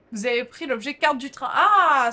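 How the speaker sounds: sudden loud burst; excited shout. Real, not acted. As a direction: blurt it out loudly. Sudden shout after item pickup.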